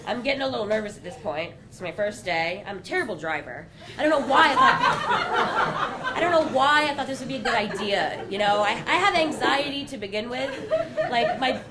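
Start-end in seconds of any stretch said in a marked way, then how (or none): 4.0-8.2 laughing. Real, not acted. Nervous laughing self-doubt.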